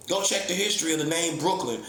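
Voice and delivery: usual speaking voice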